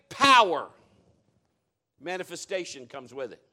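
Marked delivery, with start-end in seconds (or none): 0.1-0.7 preaching at full force. Real, not acted. Preaching climax.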